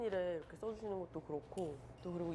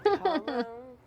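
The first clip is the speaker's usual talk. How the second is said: loud announcement; 4 semitones higher, 13 dB louder, much slower than usual. Real, not acted. Announcing the next burger.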